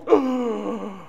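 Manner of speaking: high sharp gasp of alarm; startled gasp. Retold or imitated. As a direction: gasp as if the look stung. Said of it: "I was like".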